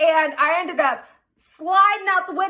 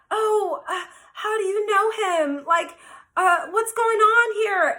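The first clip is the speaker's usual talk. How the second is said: tearful; 5 semitones higher than usual. Retold or imitated. Other woman at fence, upset.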